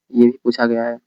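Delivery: normal talking voice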